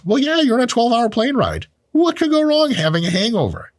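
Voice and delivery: high mock-innocent sarcasm; sarcastic. Sarcastic Vegas analogy.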